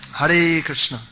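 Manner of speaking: loud closing call to the crowd; rousing call. Real, not acted. Preacher ending his talk to devotees, rousing call. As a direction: call it out for the congregation to answer.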